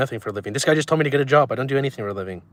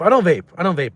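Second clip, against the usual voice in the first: higher, louder defensive denial; 4 semitones higher, 5 dB louder than usual. Real, not acted. Streamer loudly denying to chat that he vapes. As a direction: blurt it out to shut the accusation down.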